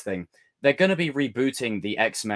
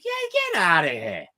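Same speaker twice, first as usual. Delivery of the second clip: mock brush-off; 8 semitones higher than usual. Brushing off the troll.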